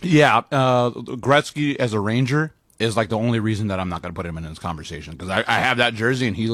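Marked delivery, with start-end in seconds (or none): none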